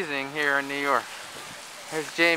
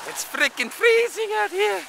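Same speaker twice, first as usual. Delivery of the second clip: very high squeal on "it's freaking freezing out here"; squeaky exaggerated complaint. Real, not acted. Exaggerated complaint about the cold.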